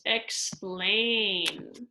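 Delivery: "explain" stretched slowly on the ending; drawn-out rhyme word. Shown as a demonstration. Teacher showing how endings rhyme, "explain" drawn out slowly. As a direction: draw out the "-ain" of "explain".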